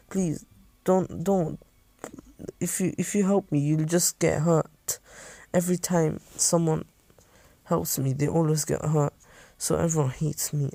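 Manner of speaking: put-on soft, high, vulnerable child's voice; understated scared kid pleading; fearful pleading. Hurt child begs not to be helped.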